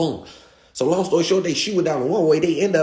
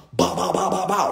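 gunfire sounds, pitched up; mock gunshots; 5 semitones higher than usual. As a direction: pop off the shots like a gun. Imitating gunshots in story.

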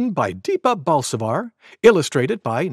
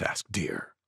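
very quiet; 11 semitones lower, 13 dB quieter than usual. A narrator's reading. Tiny narrator tag.

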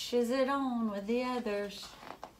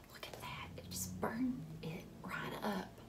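whispered, shocked aside; soft awed whisper to the children; hushed awe; 11 dB quieter than usual. Pretend. Seed burns, hushed aside.

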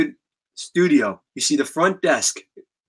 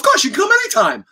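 much higher, fast eager squeak; quick high kid reply; 3 dB louder, faster than usual. Retold or imitated. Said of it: "Tom's, like, so quick".

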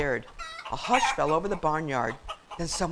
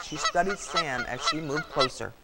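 ordinary delivery, nothing marked

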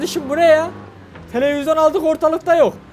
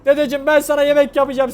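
ordinary delivery

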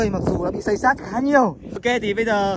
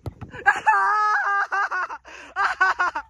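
shrieking laugh; 11 semitones higher than usual. Real, not acted. Laughing shriek after the spin.

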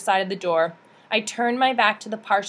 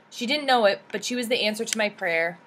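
ordinary delivery

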